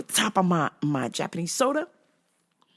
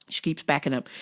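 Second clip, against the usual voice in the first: usual speaking voice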